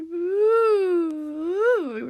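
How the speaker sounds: high, loud drawn-out ooh; long "ooh". Retold or imitated. Imitates a noise in an aside.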